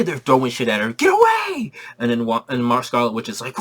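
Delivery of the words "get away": put-on high shrieking frightened kids; screaming kids. Terrified kids yelling at the stranger mom. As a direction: shriek it up high, crying in fear.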